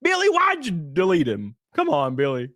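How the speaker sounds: high wailed no easing into whiny pleading; hammed-up protest; mock dismay. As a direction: plead in playful outrage. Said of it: "you're like".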